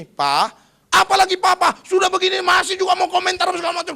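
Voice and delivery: loud, high mock shout back at dad; hammed-up shouting at father; retorting child. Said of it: "Lalu si Abdulnya bilang gini". (anak membalas ayah dengan teriakan pura-pura)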